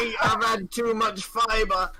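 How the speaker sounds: high shouted mock Aussie; Australian accent. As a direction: yell it like a jokey Aussie. Mocking Australians.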